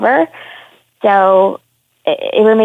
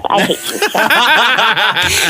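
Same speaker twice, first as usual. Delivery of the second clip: laughing; much slower than usual. Real, not acted. Teasing retort through laughter.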